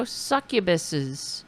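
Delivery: ordinary talk, nothing marked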